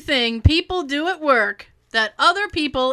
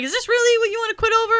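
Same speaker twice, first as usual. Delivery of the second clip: husband's voice; 11 semitones higher, much faster than usual. Retold or imitated. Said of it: "He's like".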